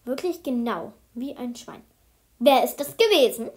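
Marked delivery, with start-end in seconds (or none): 2.4-3.5 loud, stern teacher's outburst; loud demand. A narrator's reading. Teacher demanding who squealed, loud and stern. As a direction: bark the question at the class.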